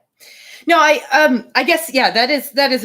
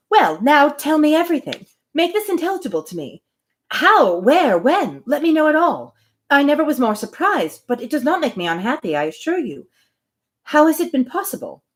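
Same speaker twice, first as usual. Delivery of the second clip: eager questioning; 7 semitones higher than usual. A narrator's reading. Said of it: "Emma eagerly demanding details".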